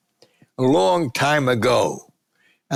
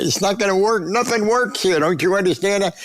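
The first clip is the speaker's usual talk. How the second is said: exasperated; 8 semitones higher than usual. Retold or imitated. Retold argument about the phone.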